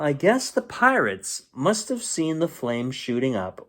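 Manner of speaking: plain reading voice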